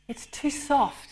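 slow, high-pitched sighed complaint; sighing. A narrator's reading. Angel judging the model of mother, sighing. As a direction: sigh it out slowly, voice lifted. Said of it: "he sighed".

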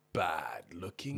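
slow whispered aside. Real, not acted. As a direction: whisper it slowly.

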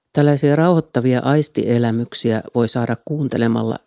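ordinary talk

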